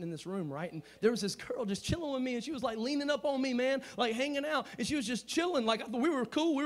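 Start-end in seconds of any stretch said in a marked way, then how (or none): none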